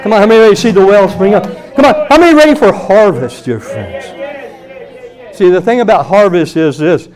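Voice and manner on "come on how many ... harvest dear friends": loud, high preacher's rally; real pulpit shout building higher; rousing call. Real, not acted. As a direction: raise the voice to fire up the congregation. Repeats rallying call to congregation louder.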